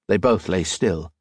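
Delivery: plain reading voice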